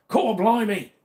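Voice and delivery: high, loud mock British exclamation; hammed-up British accent. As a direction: squeak it in a cartoon British accent. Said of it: "try to do a British accent".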